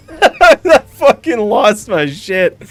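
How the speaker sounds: loud, slow words through real laughter; laughing hard. Real, not acted. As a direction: crack up while telling it. Cracking up at the retold clip.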